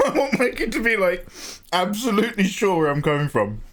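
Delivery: high, laughing impression of an over-explaining friend; giggling through a friend's earnest words; laughing mock quote. Streamer laughingly imitates his careful friend, high and giggly. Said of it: "I love when you're just like".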